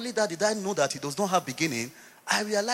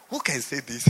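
laughing; 5 dB louder than usual. Real, not acted. Preacher laughing at the statement.

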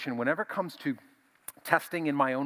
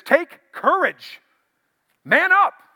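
very high, loud, slow rallying call; rally scared men to stand firm; Jesus's bold command; 14 dB louder, much slower than usual. Acted. Jesus commanding frightened disciples.

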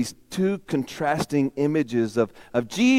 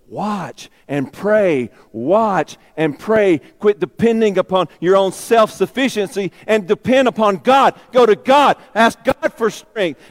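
urgent plea; 5 semitones higher, 7 dB louder than usual. Acted. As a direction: plead with him to stay awake and pray. Jesus urges Peter to pray.

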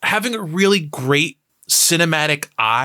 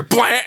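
mock stab noise; 7 semitones higher than usual. Sound effect for stabbing.

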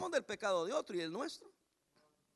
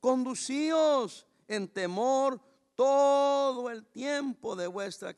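very slow, louder, weighty reading; slow emphatic reading; 3 semitones higher, 8 dB louder, much slower than usual. Shown as a demonstration. Pastor reading the verse slowly and firmly to the congregation. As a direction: lean on each word slowly. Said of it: "Se lo voy a leer despacito".

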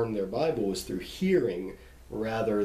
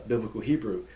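ordinary talk

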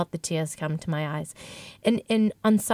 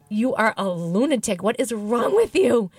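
scolding herself; 3 semitones higher, 5 dB louder than usual. Retold or imitated. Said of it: "And I thought".

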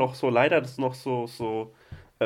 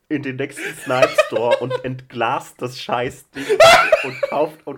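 laughing; 9 dB louder, slower than usual. Real, not acted. Joking call to riot, laughing.

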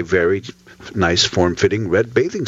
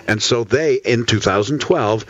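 ordinary talk

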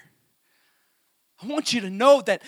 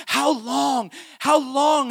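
higher, louder cry of longing; preaching shout; 7 semitones higher, 5 dB louder, much slower than usual. Acted. Preacher voicing God calling his children, loud plea. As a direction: call it out like a pleading father.

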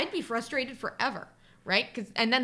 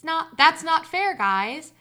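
put-on higher-pitched childish complaint; protesting; 6 semitones higher, 9 dB louder, much slower than usual. A narrator's reading. Child to brother and cousin in the dark, protesting. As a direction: protest to the pranksters, voice raised.

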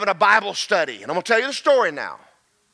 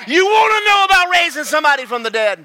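loud, high, fast challenge; rising preacher's shout; preaching shout; 5 semitones higher, 5 dB louder, faster than usual. Real, not acted. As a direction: fire the question at the crowd.

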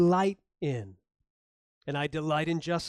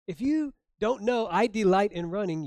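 indignant; 4 semitones higher, 5 dB louder than usual. Retold or imitated. Wife's indignant imagined reply.

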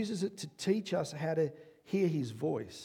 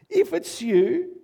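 louder plea; 10 semitones higher, 11 dB louder, slower than usual. Acted. Peter repeats, pleading harder.

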